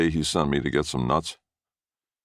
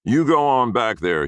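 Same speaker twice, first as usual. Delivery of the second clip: high, loud bossy command; loud order; 10 semitones higher, 5 dB louder than usual. A narrator's reading. Grandfather ordering the boy back to the thicket, loud. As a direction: order him out, booming. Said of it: "he ordered".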